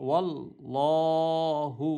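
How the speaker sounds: ওয়াল্লাহু with lips rounded on ওয়া, drawn out slowly; slow demo of ওয়াল্লাহু, lips rounded on ওয়া. Shown as a demonstration. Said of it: "ঠোঁটটা গোল হবে".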